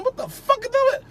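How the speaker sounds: what on earth is that number; baffled. Real, not acted. Storyteller baffled by a strange area code, high and incredulous.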